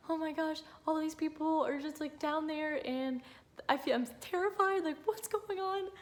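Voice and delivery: high, strained panicked voice; replaying her terror on the table; retold panic. Mother retelling her C-section fear, pitched high and panicked.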